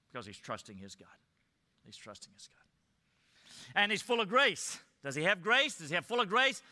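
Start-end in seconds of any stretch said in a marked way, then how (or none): 1.9-3.8 slow hushed whisper of the same words; a slow reverent whisper. Shown as a demonstration. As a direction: breathe it slowly, barely audible. Repeats the line, then whispers it.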